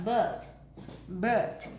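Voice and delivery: "bird" with a long central "ơ" vowel. Shown as a demonstration. Teacher repeats "bird" for students, IR as "ơ". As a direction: say "bird" with IR read as "ơ". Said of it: "chữ I R này đọc là ơ".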